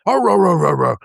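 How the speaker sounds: high mock bark, arr arr; dog barking. Dog barking at the mailman, mock bark. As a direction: yap like a guard dog chasing off the mailman.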